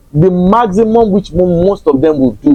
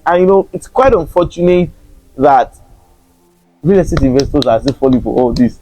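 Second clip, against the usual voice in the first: normal talking voice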